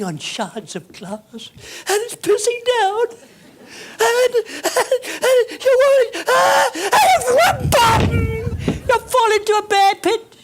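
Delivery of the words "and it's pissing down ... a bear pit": wailing victim. Acted. Comic disaster story, wailing.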